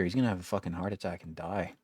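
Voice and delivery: ordinary talk, nothing marked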